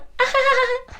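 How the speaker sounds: high-pitched imitated laugh; mock high laughter. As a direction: fake a high cackle at a bad joke. Mimics laughing at the bad joke.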